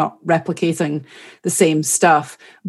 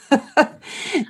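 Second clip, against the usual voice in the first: laugh; 8 semitones higher than usual. Real, not acted. Interviewer laughs at own question.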